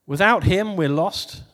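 ordinary talk

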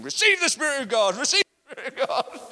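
loud, high, laughing proclamation; hearty proclaiming shout. Retold or imitated. Old man with walking stick blessing people, loud joyful shout. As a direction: call it out over everyone with gleeful zeal.

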